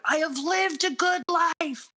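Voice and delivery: high, drawn-out emotional cry; imitated scream. Fan re-enacting a dying doctor's last words, high imitated scream. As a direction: re-enact a heartfelt scream, pitched high without much volume. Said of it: "where he screams out".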